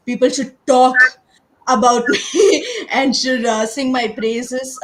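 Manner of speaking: dreaming big and laughing about it; excited boast. Retold or imitated. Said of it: "I was like".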